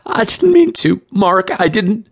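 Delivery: very high frantic pleading; hysterical, high-pitched denial; shrill, shaky. A narrator's reading. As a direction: protest in a panicked, shrill voice. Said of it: "shaky response".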